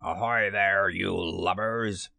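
slow, drawn-out pirate captain's hail; long-held words in a captain's put-on voice; Hook imitation. A narrator's reading. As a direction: imitate a pirate captain calling across water. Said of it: "imitated the voice of Hook".

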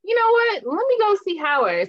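high, decided younger self; imitating her younger self, high. Guest retelling her decision to visit, a high, decided tone. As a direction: perk up and decide to go. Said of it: "So I was like".

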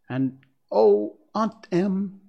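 high, emotional exclamation; heartfelt joy. Acted. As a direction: call to her aunt with overflowing relief. Girl reunited with her aunt.

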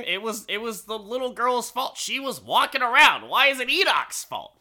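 high-pitched, hammed-up objection; mock indignant protest. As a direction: protest the unfair blame, voice climbing high. Mock protest defending Enoch.

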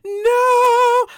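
high, loud whine; mock tearful wail. Mom imitates daughter refusing to dress, mock wailing. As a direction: stretch it into a hammed-up wail. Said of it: "she's just got, like, tears".

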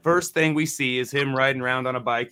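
usual speaking voice

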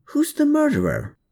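high, pressing question; anxious high-pitched demand; urgent demand. A narrator's reading. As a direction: push hard for the name. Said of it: "Klaus yelped".

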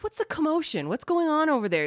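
lightly hammed-up Lean wondering about the fuss; imitating David Lean. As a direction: play the puzzled director looking over. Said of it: "He's like".

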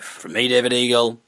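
normal talking voice

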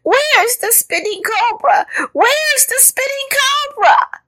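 very high, slow panicked squeal; frightened wailing. A narrator's reading. Caterpillar still scared while others laugh, frightened wail. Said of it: "He was still saying".

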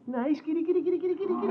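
calling kitty, high and rising; high coaxing call. Acted. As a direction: coax the lion in a high voice. Coaxing a lion.

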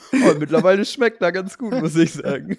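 high, loud laughing confession. Real, not acted. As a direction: laugh through the words. Laughing joke about schnapps.

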